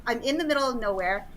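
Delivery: ordinary talk, nothing marked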